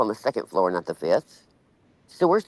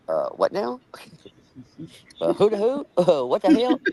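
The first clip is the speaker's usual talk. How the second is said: laughing disbelief; 5 semitones higher than usual. Retold or imitated. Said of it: "And I'm like".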